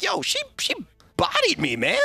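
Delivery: lightly voiced stunned teammate; quoting a player. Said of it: "He's like".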